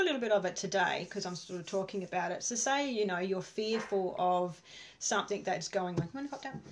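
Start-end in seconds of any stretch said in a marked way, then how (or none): 6.1-6.6 pet talk. Real, not acted. Coaxing dog off lap.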